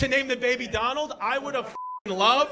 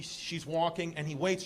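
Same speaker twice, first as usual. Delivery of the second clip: ordinary talk, nothing marked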